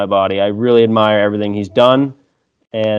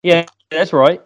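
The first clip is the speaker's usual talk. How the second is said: put-on "Yeah, that's right." pitched up in a jokey Australian accent; mock Australian accent; 7 semitones higher than usual. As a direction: play-act an Aussie bloke, higher. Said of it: "it's a great accent".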